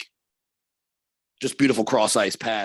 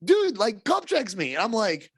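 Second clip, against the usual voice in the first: normal talking voice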